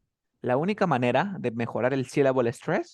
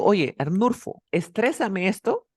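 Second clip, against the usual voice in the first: slow, higher, emphatic "estrésame esto"; demonstrated request; 6 semitones higher, 3 dB louder, slower than usual. Shown as a demonstration. Teacher giving student an example request, slow emphatic delivery. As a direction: stretch "estrésame esto" and lift it as an instruction. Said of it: "Cuando yo te digo".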